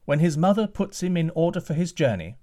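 calling out high and loud; a king's call from the castle walls. Acted. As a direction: call down from the battlements. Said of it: "called the king from the battlements".

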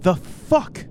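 high-pitched, acted outrage; stunned disbelief. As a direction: break it up, aghast at what just happened. GM voices her after fireball.

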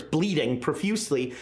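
ordinary delivery

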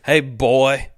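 slow mock Australian drawl with a push; hammed-up Australian accent. Host plays Philip as an Aussie dad teaching his boy to fish, hammed-up Australian accent. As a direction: do a cheesy outback Australian accent. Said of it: "what is this accent?".

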